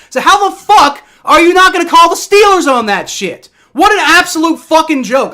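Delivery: high, loud furious rant; furious yelling. Real, not acted. Fan rages at the refs' double standards, yelling. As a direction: let him have it, full fury. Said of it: "I'm absolutely fucking furious".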